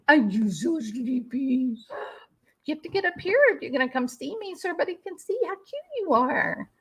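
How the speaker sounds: high pet voice calling the dog; talking sweetly to her dog. Real, not acted.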